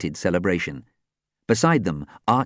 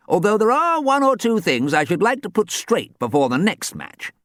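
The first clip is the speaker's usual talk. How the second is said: lightly voiced old football ghost; 14 semitones higher, 4 dB louder than usual. Archie's voice.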